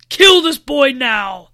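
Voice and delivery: loud, slow villain's command; yelled order. A narrator's reading. Lane ordering the dragons against Noah, loud slow yell. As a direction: yell the order, drawing the words out. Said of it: "yelled Lane".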